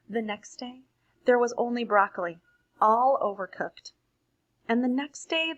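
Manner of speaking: lively read-aloud to a class; storybook reading. Acted. Teacher reads picture book aloud.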